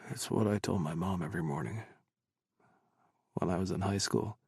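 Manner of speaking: low, soft, drowsy murmur; hushed low character voice; soft sleepy voice. A narrator's reading. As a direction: speak softly and low, still half asleep. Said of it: "his soft voice".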